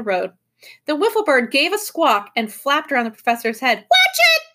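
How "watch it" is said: very high, loud squawky call; high-pitched shouted call. A narrator's reading. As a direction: squawk it out like a bird. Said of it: "she called".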